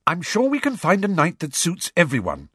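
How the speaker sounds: high-pitched, a little impatient; slightly impatient. A narrator's reading. Schoolgirl settling a meeting night, slightly impatient. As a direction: hurry them past the objection with mild impatience. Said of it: "said Hermione slightly impatiently".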